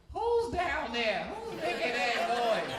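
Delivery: put-on high, slow, loud old lady calling out; nosy old lady. Old neighbour calling out at a noise in the night, high and slow. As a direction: shout down into the dark like a suspicious granny.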